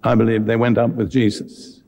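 ordinary talk, nothing marked

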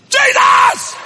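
high, loud holler of Jesus; hollered name. Retold or imitated. Preacher praying loudly in the bank line, hollering. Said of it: "I just hollered".

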